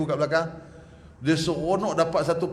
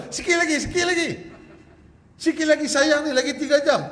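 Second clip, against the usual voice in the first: coaxing parent; 10 semitones higher, 3 dB louder than usual. Retold or imitated. Mimics parent coaxing fasting child.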